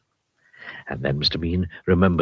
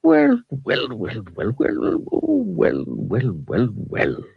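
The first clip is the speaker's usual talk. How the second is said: high wizard voice savouring each well; musing wizard; 6 semitones higher, much slower than usual. A narrator's reading. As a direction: let the wizard muse over the spilled money. Wizard mulling over money.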